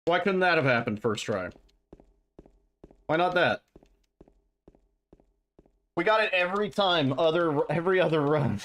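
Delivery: high, whiny complaint; exasperated. Real, not acted. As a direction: whine in disbelief. Frustrated complaint.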